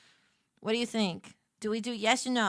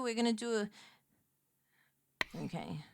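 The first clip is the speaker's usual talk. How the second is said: usual speaking voice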